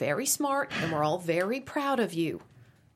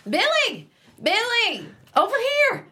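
high, loud call across the room; 6 dB louder than usual. Acted. Mom calling across the restaurant to Billy, loud and high. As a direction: call him over loudly.

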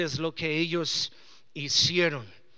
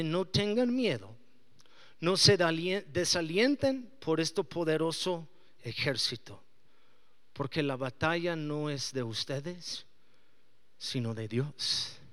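lower, softer solemn scripture; reverent reading; 4 semitones lower, 3 dB quieter than usual. Acted. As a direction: read God's words with calm weight. Reading God's words lower. (lectura solemne y suave)

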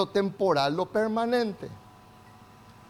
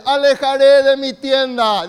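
loud declaration; 3 semitones higher, 9 dB louder than usual. Real, not acted. Preacher leading the church in a loud declaration. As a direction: call it out loud for the church to repeat. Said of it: "digan conmigo bien fuerte".

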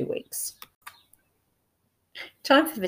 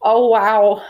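breathless, louder wow of wonder; awestruck gasp; 4 semitones higher, 5 dB louder, much slower than usual. Retold or imitated. Viewer reacting to a great performance with awe. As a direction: let the film leave you amazed. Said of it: "you just think".

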